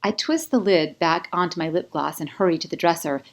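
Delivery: ordinary delivery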